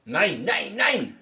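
loud, high, rising string of no's; hammed-up German "nein"; mock German refusal. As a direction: refuse in cartoon German, climbing each time.

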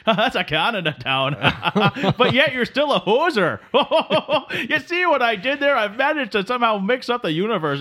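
giddy laughing boast. Real, not acted. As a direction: cackle while you brag. Host laughing at own joke.

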